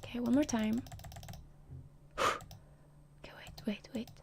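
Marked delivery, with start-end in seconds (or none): none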